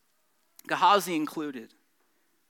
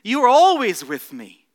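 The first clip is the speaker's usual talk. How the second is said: loving father; 5 semitones higher, 11 dB louder than usual. Retold or imitated. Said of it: "The response of the father to the older son is".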